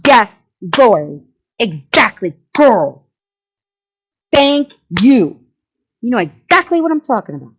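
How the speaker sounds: hammed-up Southern gushing agreement; Southern belle gushing, loud and high; mock Southern accent. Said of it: "With a Southern accent".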